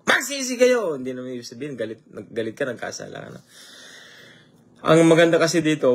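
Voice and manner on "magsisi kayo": a sudden high call to repent; preacher's shout. Shown as a demonstration. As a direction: shout it out like calling sinners to repentance. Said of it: "sumigaw ako rito".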